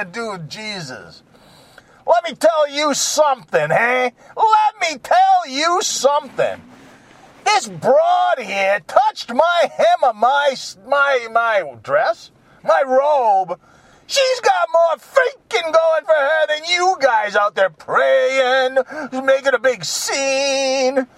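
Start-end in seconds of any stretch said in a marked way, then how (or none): 2.1-4.1 higher, brash, crying-out opener. Retold or imitated. 4.4-6.6 higher, slower, shouted repeat. Retold or imitated. 7.5-21.1 higher, slow, brash tough-guy voice. Retold or imitated.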